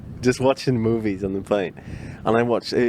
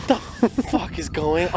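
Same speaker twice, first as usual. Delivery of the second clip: slow, much higher stunned disbelief; shocked disbelief; 8 semitones higher, slower than usual. Retold or imitated. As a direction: gasp it out in tearful shock. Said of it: "I'm there on this plane going".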